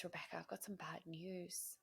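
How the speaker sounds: soft, subdued retold agent; grave bad news. Agent calls Rebecca with bad news, grave and hushed.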